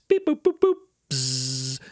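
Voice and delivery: microwave beeps. Pretend. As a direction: imitate a microwave beeping and buzzing. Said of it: "we're hitting power high, two minutes".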